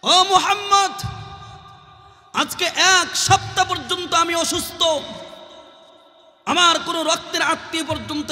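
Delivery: high, impassioned mock wail; tearful cry, retold. Sick old woman tearfully crying out to the Prophet, high wail. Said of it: "চোখের পানি ছেড়ে দিয়ে চিৎকার করে বলে".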